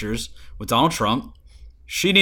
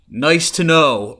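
sarcastic; 4 semitones higher, 7 dB louder, much slower than usual. Retold or imitated. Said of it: "that was sarcasm".

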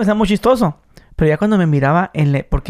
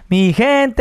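put-on northern Mexican accent; 7 semitones higher than usual. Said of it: "ese es como un acento norteño".

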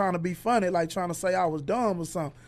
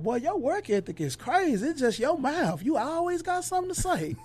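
lightly voiced older woman, pitched up, laughing at the end; teasing kitchen lady; 6 semitones higher, 3 dB quieter than usual. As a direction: tease him about his mouth.

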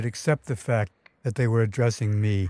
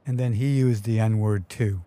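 usual speaking voice

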